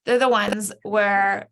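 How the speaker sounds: usual speaking voice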